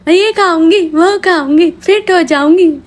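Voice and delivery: sing-song her empty promise to get fit; mock diet vow. Mocking the wife's promise to diet, high sing-song boast.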